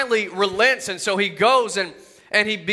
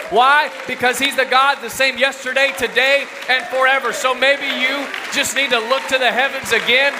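raised, ringing sermon voice that keeps pushing upward; fervent preaching; 3 semitones higher than usual. Acted. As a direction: preach it hard and call the crowd to look again. Preaching at full force.

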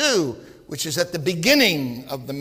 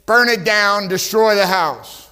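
louder, a stern priest's final verdict; stern shouted order; 3 semitones higher, 6 dB louder, slower than usual. Acted. Priest ordering the leper house destroyed, stern shout. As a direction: bark out the order to burn it.

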